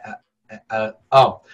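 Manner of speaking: ordinary delivery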